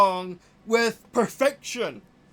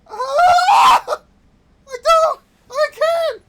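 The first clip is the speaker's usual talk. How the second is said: real high-pitched scream of exasperation; frustrated screaming; 12 dB louder, much slower than usual. Real, not acted. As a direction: lose it completely, shriek the words. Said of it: "Sorry that I'm yelling".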